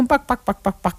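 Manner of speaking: light barnyard chicken bok-bok; chicken clucks. Shown as a demonstration. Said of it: "'cause there's chickens".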